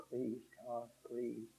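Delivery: soft plea. Retold or imitated. Begging God in retold prayer.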